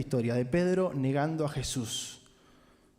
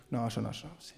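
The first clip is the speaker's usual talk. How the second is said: softer, lower, stumbling over the words; flustered denial; 5 semitones lower, 8 dB quieter than usual. Acted. Peter denying he knew Jesus, hushed and flustered. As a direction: caught out and backing away.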